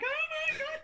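high-pitched pop-star shriek, played for laughs; mock panic. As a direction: squeal as if spooked, imitating them. Imitates pop stars' scared reaction.

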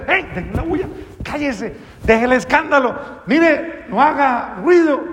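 high-pitched, acted hushing of a noisemaker; scolding shouts. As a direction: scold like an annoyed crowd. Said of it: "Muchos comenzaron a gritar, a decir".

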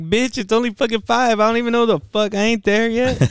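high-pitched mock indignation; retold indignant reply. Retold indignant reply to friend's text.

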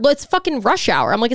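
usual speaking voice

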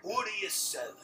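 merchant impression. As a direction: pitch it up like a game shopkeeper. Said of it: "The merchant is like".

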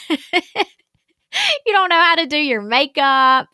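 high, loud, slow sneering inner-critic voice; voicing her harsh inner critic, mocking and loud. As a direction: ham up the nasty voice in your head. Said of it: "those were the thoughts in my head was like".

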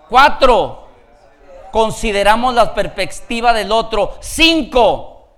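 loud, high recap, numbers punched; emphatic preaching. Real, not acted. Preacher recapping advice on resolving conflicts, emphatic preaching. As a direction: count off each point with force.

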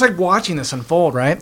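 ordinary talk, nothing marked

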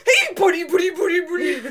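high, loud cooing voice; baby talk. Retold or imitated. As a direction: gush at a baby or a puppy. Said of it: "when they see a baby".

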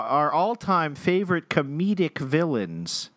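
ordinary talk

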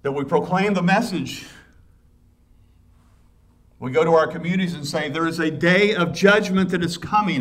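loud, high proclaiming cry; preaching at full force. Acted. Preacher telling how they warn communities, loud and high. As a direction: proclaim it to the whole town.

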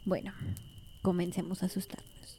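spooky whispered invitation; hushed campfire whisper. Pretend. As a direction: breathe it out like a secret by the fire. Starting scary stories by fire.

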